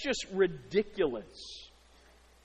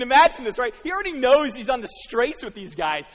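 normal talking voice

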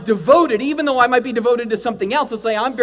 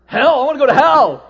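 loud, keen cry; loud mock enthusiasm; eager cheer; 6 semitones higher, 11 dB louder than usual. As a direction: sell wanting hell with gusto. Acting eager for hell.

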